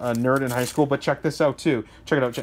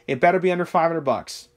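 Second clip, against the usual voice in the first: ordinary talk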